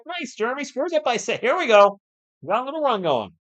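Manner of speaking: high, bright-up cheer for the basket; excited cheering. Real, not acted. Streamer cheering his team's basket, excited and high. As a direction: cheer your team's bucket, pitch way up.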